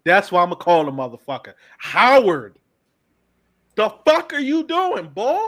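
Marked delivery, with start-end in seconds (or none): 3.8-5.4 exasperated. Real, not acted. Fan reacting to the Eagles trade, high exasperated cry.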